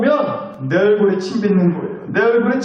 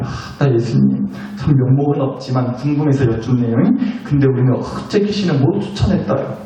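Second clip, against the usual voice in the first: sheepish question; 6 semitones lower than usual. Acted. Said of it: "예수님한테 질문했어요".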